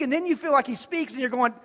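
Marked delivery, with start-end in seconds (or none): none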